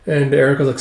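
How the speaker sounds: ordinary talk, nothing marked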